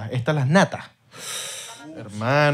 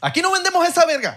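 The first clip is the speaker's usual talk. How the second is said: much higher, laughing clerk voice; mock shop clerk, pitched up with a laugh; 14 semitones higher than usual. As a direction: play a fed-up clerk, high and joking. Acting shop clerk refusing.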